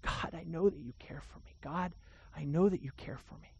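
hushed, murmured repeated prayer; quietly imitating a grieving father's prayer; soft retold prayer. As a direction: murmur it softly, over and over. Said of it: "But he's just saying".

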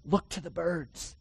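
hushed reassurance after the question; soft answer. Shown as a demonstration. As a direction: say it quietly, almost to yourself. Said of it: "Are you sure?".

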